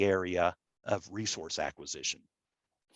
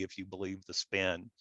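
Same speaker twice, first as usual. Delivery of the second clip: usual speaking voice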